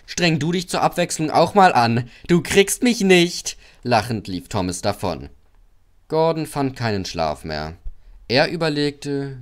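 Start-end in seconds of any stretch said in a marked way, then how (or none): 0.1-3.6 high, loud teasing; cheeky taunt. A narrator's reading. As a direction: taunt loudly, stretching the final dare. Cheeky engine taunts, then runs off.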